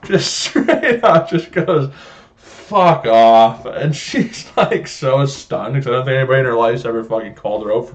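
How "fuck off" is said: much louder imitation of a fed-up dad; loud blunt dismissal. Son retelling dad telling off waitress, loud and blunt. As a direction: blast it out at the waitress.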